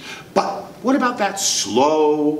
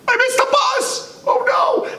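panicked shout. Acted. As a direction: cry out in panic. Kid panics at empty stop.

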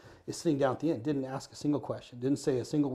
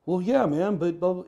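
high and louder, fumbling for an excuse; sheepish excuse; 7 semitones higher, 7 dB louder, slower than usual. Retold or imitated. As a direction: caught out, stammering a defence. Official caught out.

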